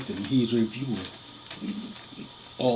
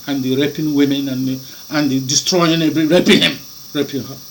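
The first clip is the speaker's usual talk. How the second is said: normal talking voice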